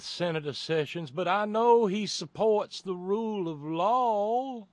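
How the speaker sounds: hammed-up Southern senator, stretching the last words; mock Lindsey Graham. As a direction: lampoon a pious Southern politician. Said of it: "Lindsey Graham".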